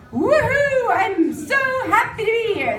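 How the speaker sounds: high whooping mock joy; mock cheering fan. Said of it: "and be like".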